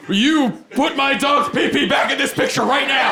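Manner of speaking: high, loud mock-outraged order; mock indignant demand. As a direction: demand it back, hammed-up. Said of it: "go back to the studio and go".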